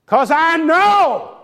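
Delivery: high, drawn-out preaching cry; imitated Pentecostal preacher. Minister to the corpse in a charismatic church, preaching. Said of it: "that's how they speak".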